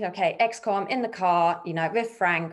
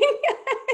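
a short, high burst of laughter; real laughter, high; 4 dB louder than usual. Real, not acted. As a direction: laugh at the embarrassment. Embarrassing car music.

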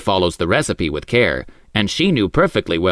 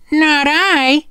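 high, loud cat voice; a prim little cat saying no; 3 dB louder than usual. A narrator's reading. Cat refuses.

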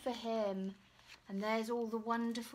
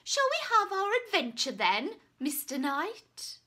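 eager dragon; 7 semitones higher, 6 dB louder than usual. Acted. Dragon inviting the knight.

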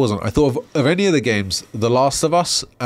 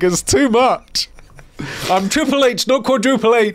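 mock Triple H whine; 11 semitones higher than usual. Mocking Triple H overwhelmed by Discord buttons. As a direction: play him baffled and overwhelmed. Voicing Triple H's complaint.

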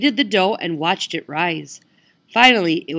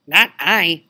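louder, oinking pig voice; 7 dB louder than usual. Acted. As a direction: oink it like a rude pig. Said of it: "oinked the rude pink pig".

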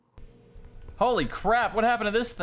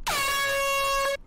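sudden high yelp of fright; short real scream, high; startled shriek. Real, not acted. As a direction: jump out of your skin with a scream. Jump scare.

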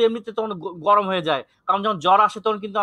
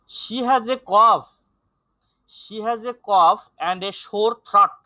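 very slow, firmly voiced classroom reading; slow, deliberate teacher's demonstration; model reading; 3 dB louder, much slower than usual. Shown as a demonstration. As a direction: read each word out slowly for students. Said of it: "teacher's model reading".

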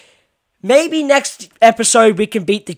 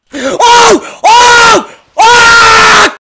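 startled yelp; 12 semitones higher, 8 dB louder than usual. Real, not acted. Jump scare.

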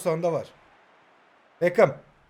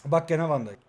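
usual speaking voice

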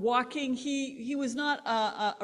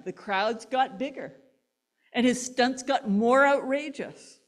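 normal talking voice

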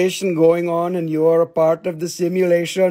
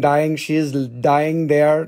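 ordinary talk

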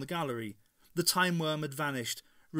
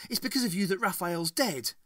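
snapping; 6 semitones higher, 4 dB louder than usual. A narrator's reading. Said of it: "she snapped".

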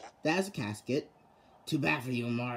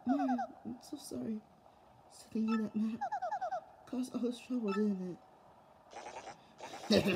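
soft princess voice; 7 semitones higher, 7 dB quieter than usual. Acted. Voices Peach, captive and apologetic.

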